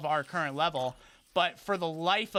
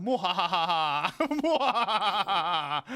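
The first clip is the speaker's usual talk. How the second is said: slow hammed-up villain cackle; drawn-out mock evil mwahaha; mock evil laugh; 3 dB louder, much slower than usual. Gloating villain laugh on tower.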